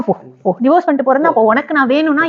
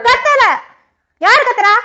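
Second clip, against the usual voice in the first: raised, indignant denial; mock shout; 9 semitones higher, slower than usual. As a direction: shout while claiming you aren't shouting. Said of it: "நீ தான் கத்துற".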